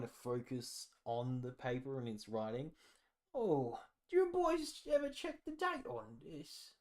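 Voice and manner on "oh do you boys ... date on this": put-on high, creaky old man asking the boys; a doddery old villager squinting at strangers; lightly voiced old man, high and quavery.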